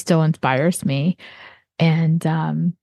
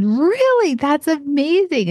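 much higher, delighted and encouraging; excited praise; 9 semitones higher, slower than usual. Retold or imitated. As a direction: gush over a kid's achievement. Praising young bracelet maker.

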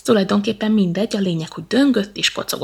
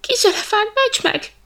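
much higher, loud cry for help; monkey shrieking in fear; terrified cry; 14 semitones higher, 5 dB louder than usual. Acted. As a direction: scream for rescue in panic. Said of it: "rikkantotta a majom rémületében".

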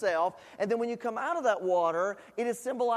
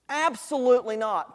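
firm, louder and higher ABSOLUTELY NOT; emphatic; 3 semitones higher, 4 dB louder than usual. Shown as a demonstration. Preacher stressing the apostle's answer, emphatic and loud. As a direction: land it hard like a shouted refusal. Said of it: "let's be a little bit more emphatic about that statement".

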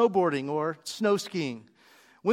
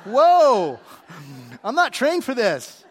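startled protest; 2 semitones higher, 7 dB louder, slower than usual. Retold or imitated. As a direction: blurt it out, voice jumping up in alarm. Acting out his startled reaction.

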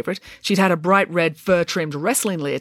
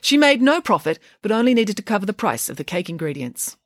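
usual speaking voice

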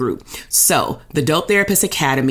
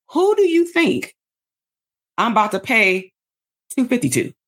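much higher mocking voice; mock vague pitch; 6 semitones higher than usual. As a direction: send up a weak sales pitch, pitched way up. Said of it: "compared to".

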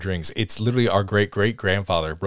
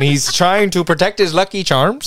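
mock Irish accent; 8 semitones higher, 4 dB louder than usual. Co-host teasing a friend's failed accent, in mock Irish. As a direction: tease with a hammed-up Irish accent. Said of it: "you were going for Irish".

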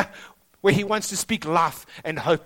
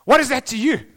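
louder sharp challenge on "What is that to you?"; firm rebuke; 4 semitones higher, 7 dB louder than usual. Shown as a demonstration. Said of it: "I wonder what tone of voice".

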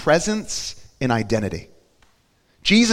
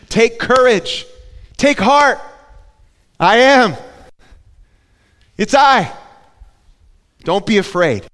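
loud call; 7 semitones higher, 6 dB louder than usual. Acted. Jesus calling over the storm.

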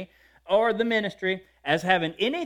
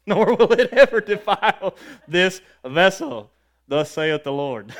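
mock prophet; 7 dB louder than usual. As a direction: proclaim it like a hammed-up Old Testament prophet. Mock prophetic declaration over corn dogs.

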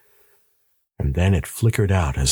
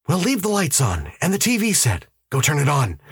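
higher, insistent husband's voice; urgent husband; 9 semitones higher than usual. A narrator's reading. Husband warning his wife of a powerful enemy, urgent. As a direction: press the warning on a disbelieving wife. Voices Ed giving hurried orders.

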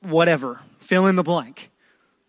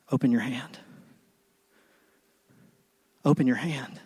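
gentle invitation; 4 semitones lower, 6 dB quieter than usual. Retold or imitated. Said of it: "He's saying".

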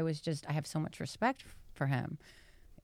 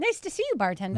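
a squeaky high greeting, much higher; mock eager hello; 5 semitones higher, 5 dB louder, faster than usual. Spotting the bartender again, chirping a high hello.

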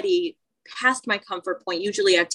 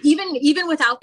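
laughing; 9 semitones higher, 5 dB louder than usual. Real, not acted. Laughing along with friend.

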